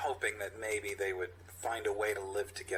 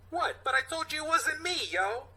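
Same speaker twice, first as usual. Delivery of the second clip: much higher, whiny protest; protesting kid. Acted. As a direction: plead like a wronged kid.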